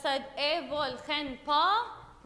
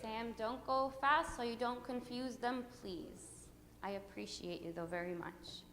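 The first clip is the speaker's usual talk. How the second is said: quiet aside; 3 semitones lower, 11 dB quieter than usual. Real, not acted. Teacher's hushed aside to Sam.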